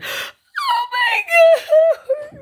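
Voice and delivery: very high, drawn-out wailing shriek; hammed-up hysterical happy scream; imitated crying scream. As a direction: re-enact screaming and sobbing over a jackpot. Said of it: "crying, screaming, freaking out".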